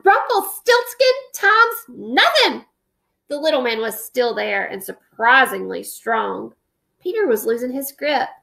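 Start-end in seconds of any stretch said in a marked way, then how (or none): 0.0-2.6 high, slow straining yell; yelling while gripping the stick; desperate shout. A narrator's reading. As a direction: shout with all his might.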